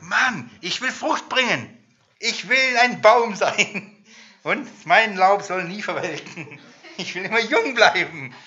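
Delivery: höher und langsamer, übereifrig fromm; naiver Übereifer. Retold or imitated. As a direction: spielt den naiven Frommen, der alles will. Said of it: "den Psalm und denk". (Prediger ahmt den Übereifrigen nach, höher, langsam)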